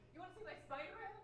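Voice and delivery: high, laughing mock boss voice; laughing impression. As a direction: tease him through laughter. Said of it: "he's like".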